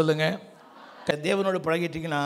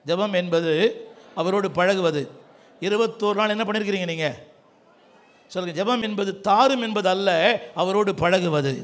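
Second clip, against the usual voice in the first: ordinary delivery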